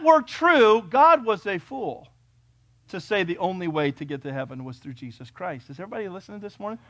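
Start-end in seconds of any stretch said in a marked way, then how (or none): none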